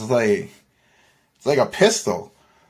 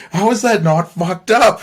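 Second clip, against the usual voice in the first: wife quoted, higher and louder, upset; a hint of the wife's shock, pitched up; 7 semitones higher, 4 dB louder than usual. Scared wife reacts to gun offer.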